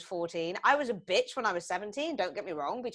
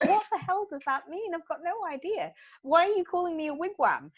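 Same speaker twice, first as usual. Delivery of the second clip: higher-pitched mock-outraged parent; exasperated retold protest, pitched up; baffled indignation; 9 semitones higher than usual. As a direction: act out being utterly baffled and affronted. Acts out baffled reply to daughter.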